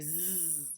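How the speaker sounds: "Z" buzzed softly; z sound. Shown as a demonstration. Teacher showing the s sounding like z. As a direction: hum the "Z" sound. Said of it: "sounds like".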